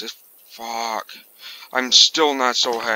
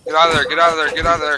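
louder urgent repeated command; urgent shouting; 4 semitones higher, 7 dB louder, much faster than usual. Real, not acted. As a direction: yell at the unit to flee. Urging unit to flee.

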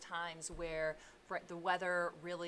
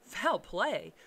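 mild alarmed outcry, a little louder; frightened cry; 3 semitones higher, 8 dB louder than usual. A narrator's reading. Lawyer alarmed by the butler's suspicion, a frightened cry. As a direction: cry it out, startled and questioning. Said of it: "cried the lawyer, a good deal frightened".

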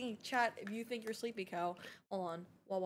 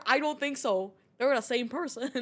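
ordinary talk

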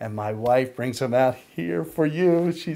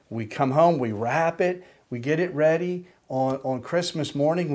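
normal talking voice

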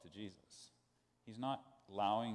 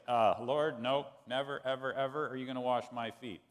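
louder, insistent no from Peter; emphatic refusal; 2 semitones higher, 7 dB louder than usual. Retold or imitated. Peter refusing Jesus to wash his feet, loud emphatic no. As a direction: plant your feet and flatly refuse. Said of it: "it's really emphatic in the text".

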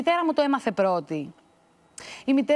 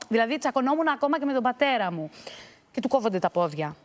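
usual speaking voice

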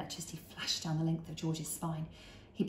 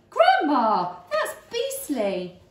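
disgusted outcry; 8 semitones higher, 10 dB louder, much slower than usual. Acted. Boy disgusted.

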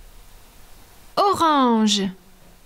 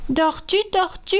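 seahorse wake-up call; 7 semitones higher, 4 dB quieter, faster than usual. A narrator's reading. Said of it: "glisse jusqu'à elle et dit".

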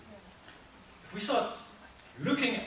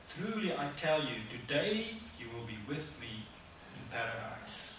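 calm reassurance; 7 dB quieter than usual. Acted. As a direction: speak it softly, like a comfort. Jesus answers the criminal.

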